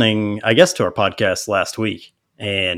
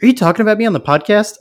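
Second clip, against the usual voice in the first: high, fast accusing question; suspicious wife; 12 semitones higher, 6 dB louder, faster than usual. Retold or imitated. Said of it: "she's like".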